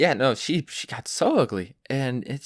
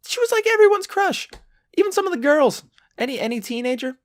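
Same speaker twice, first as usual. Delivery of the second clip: gushing crush. Real, not acted. Gushing about Lindsay Lohan.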